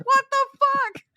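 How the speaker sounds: very high, loud shocked yelp; mock pained outburst. Jay to Jonah after being stabbed, a very high loud yelp. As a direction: yelp in shock at the knife in the leg. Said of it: "he's like".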